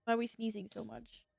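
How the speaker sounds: ordinary talk, nothing marked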